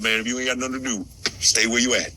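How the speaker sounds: low, mocking Southern grandma voice. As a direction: imitate a Southern grandma passing on advice. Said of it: "Like my grandma said".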